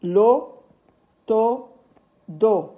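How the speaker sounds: slowly stretching the syllable lo; slow and careful, like reading to young pupils; slow teacher delivery. Shown as a demonstration. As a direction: draw out lo for the class. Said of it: "On a ici lo".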